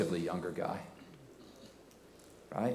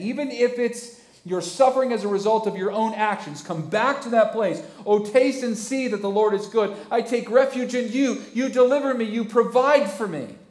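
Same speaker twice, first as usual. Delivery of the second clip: much higher and louder, fervent climax; full-force preaching; 12 semitones higher, 10 dB louder than usual. Real, not acted. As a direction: cry it out to the church. Preaching climax, declaring faith.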